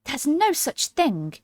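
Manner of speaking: understated indignant protest; angrily. A narrator's reading. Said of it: "very angrily".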